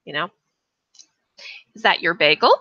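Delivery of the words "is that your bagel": "bagel?" lifted at the end in a question; rising question on "bagel". Shown as a demonstration. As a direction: ask "Is that your bagel?" with a rising hook. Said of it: "The little hook at the end, we go up and down".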